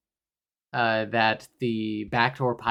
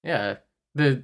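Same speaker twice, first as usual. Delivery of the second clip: ordinary talk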